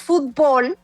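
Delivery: fútbol said "fut-BOL"; stress on last syllable. Shown as a demonstration. Guest explaining Mexican usage, fútbol as fut-BOL. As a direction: push the final syllable of fútbol. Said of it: "es una palabra aguda".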